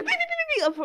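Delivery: high steady monitor beeps; squeaky monitor beeping; imitated heart monitor. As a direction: chirp like a hospital monitor. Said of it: "of her heart monitor".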